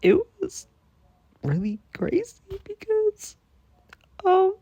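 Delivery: fighting tears. Real, not acted. Telling viewers about the video, near tears. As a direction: squeeze it out as if about to cry. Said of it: "I'm trying not to cry".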